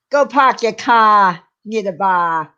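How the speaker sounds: put-on Boston accent with dropped Rs. Streamer shows off an accent to co-host, Boston accent. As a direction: drop every R like a Bostonian. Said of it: "That was my Boston accent".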